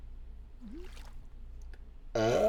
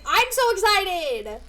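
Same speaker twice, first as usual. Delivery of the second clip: high, loud, drawn-out excitement; slow, loud, thrilled cry; excited squeal; 6 semitones higher, 7 dB louder, slower than usual. Real, not acted. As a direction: stretch it out, bursting with glee.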